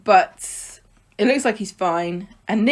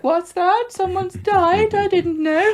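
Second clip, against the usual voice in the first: much higher, slow, faux-innocent voice; mock oblivious bystander; 12 semitones higher, slower than usual. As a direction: play dumb and wide-eyed, way up in pitch. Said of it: "everyone's like".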